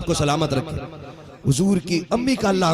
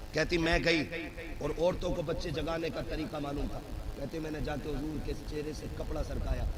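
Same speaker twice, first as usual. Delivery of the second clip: much softer, near-hushed sermon narration; hushed storytelling; 2 semitones lower, 6 dB quieter than usual. Acted. Preacher softly describing Halima at the cradle, hushed. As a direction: drop to a hush over the sleeping child.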